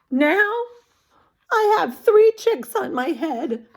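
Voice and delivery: distressed elephant; upset Elephant. Acted. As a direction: whine about the chicks.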